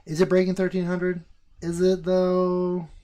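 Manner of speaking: loud, high mocking doubt; loud sarcastic disbelief; skeptical. Real, not acted. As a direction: drag out the doubtful question. Mock disbelief at price.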